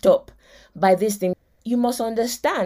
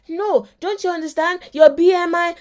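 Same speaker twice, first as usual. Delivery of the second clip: higher, louder urging wife; pleading wife; 6 semitones higher, 3 dB louder than usual. Retold or imitated. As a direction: press him about his weight, pitched up. Wife urging husband about weight.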